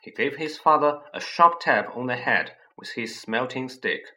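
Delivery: ordinary delivery, nothing marked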